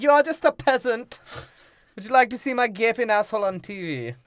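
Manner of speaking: high, loud put-on voice; haughty mock insult. Comic acting out a TV feud with Bill O'Reilly, high haughty voice.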